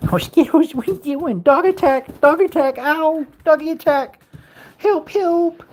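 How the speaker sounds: high excited play cry for help; high loud mock panic; playful mock cries. Real, not acted. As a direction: ham up a dog attack. Dog jumping on him playfully.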